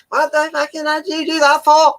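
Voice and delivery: high squeaky Welsh ranting; shrill-voiced mock Valleys rant; south wales valleys accent. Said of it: "bad impression, mate".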